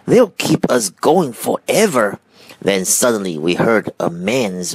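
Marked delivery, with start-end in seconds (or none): 0.1-2.2 higher, marvelling monkey voice; lightly voiced excited monkey; excited cry. A narrator's reading. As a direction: exclaim it with eager wonder. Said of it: "cried the monkeys".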